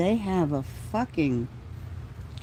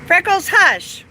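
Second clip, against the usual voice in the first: sharp, much louder and higher call to the dog; scolding the dog; 12 semitones higher, 8 dB louder, slower than usual. Real, not acted. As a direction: snap at the dog to hush, slowly.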